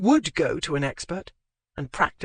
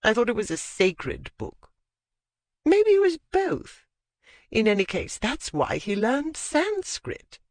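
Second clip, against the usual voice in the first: put-on old lady voice; 6 semitones higher than usual. A narrator's reading. Lady Withcote voiced high.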